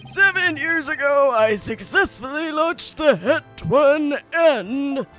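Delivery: put-on slow, loud bragging old man. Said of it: "old man voice".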